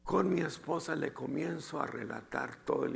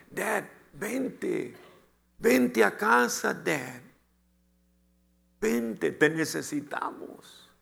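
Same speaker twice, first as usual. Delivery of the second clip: higher-pitched, drawn-out pleading; son's retold plea; 6 semitones higher, 3 dB louder, slower than usual. Ten-year-old son begging dad to come home, pleading. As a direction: plead like a child calling a parent home.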